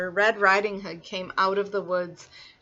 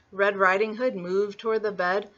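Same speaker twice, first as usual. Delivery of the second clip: ordinary delivery, nothing marked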